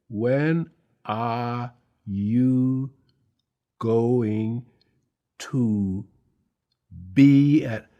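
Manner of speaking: each word read out slowly and carefully; slow school reading. Retold or imitated. Nervous actor reading his audition line slowly, word by word. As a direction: spell it out like a struggling schoolboy reader. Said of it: "I am very slow".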